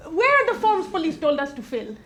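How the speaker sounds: normal talking voice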